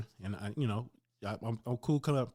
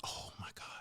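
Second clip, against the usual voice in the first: whispered, much softer gasp of shock; 13 dB quieter than usual. Retold or imitated. Gym owner realizing who it is, stunned whisper. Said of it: "he looked shocked".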